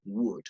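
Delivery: "Would" said "wud", no L; silent L. Shown as a demonstration. Teacher repeats would with silent L. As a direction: drop the L in "Would". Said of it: "La L es muda en este caso".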